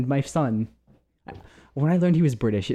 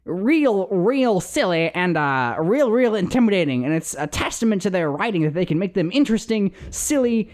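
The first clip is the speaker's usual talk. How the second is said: put-on Southern accent; 5 semitones higher than usual. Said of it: "I can't do the Southern accent anymore".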